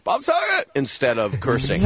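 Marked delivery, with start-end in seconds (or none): 0.0-0.7 high yelled name; yelled tic. Retold or imitated. Tourette's guy yelling Bob Saget. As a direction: yell the name out high and sudden. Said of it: "he yells".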